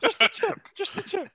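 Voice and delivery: squeaky high pleading; hammed-up falsetto coaxing; high mock voice.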